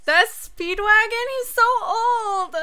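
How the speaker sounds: high, loud disbelieving cry; shocked. Real, not acted. Shocked reaction to aged character.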